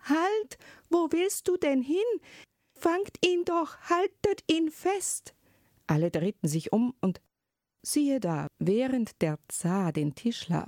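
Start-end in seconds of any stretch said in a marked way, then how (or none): 0.0-5.4 high alarmed cry, much higher; tsarina crying out. A narrator's reading. Tsarina calls after her son, high frantic cry. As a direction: shriek after the flying boy in panic. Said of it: "Da rief plötzlich die Zarin".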